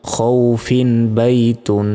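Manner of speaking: খৌফিন বাইতুন read slowly and joined, no stop after খৌফিন; slow, linked recitation with no stretch. Shown as a demonstration. Teacher shows reading on without pausing, slow. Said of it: "যেমন".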